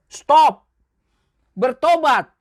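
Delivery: a loud, sharp order to stop; firm command. Real, not acted. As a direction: cut them off with a loud command. Preacher commands the gambler to quit. (perintah tegas dan keras)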